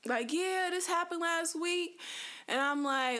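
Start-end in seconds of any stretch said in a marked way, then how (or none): 0.3-2.0 high-pitched imitation of a family member; relative telling story. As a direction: lightly act out a relative mid-story, pitched up. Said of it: "they could be telling a story".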